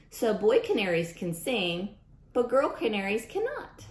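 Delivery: lively storybook read-aloud voice; reading to children. Acted. Teacher reading a rhyming book to kids, storybook voice. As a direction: read it out to the class with bounce.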